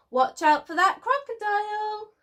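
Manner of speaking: high, loud warning yell; mock yell from an older cousin. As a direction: shout a warning across the grass. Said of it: "her older cousin yelled".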